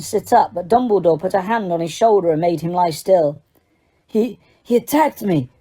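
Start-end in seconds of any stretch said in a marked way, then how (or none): 4.1-5.5 a hint of a dazed Krum; mumbled, shaken young man; muttered. A narrator's reading. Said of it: "Crumb muttered".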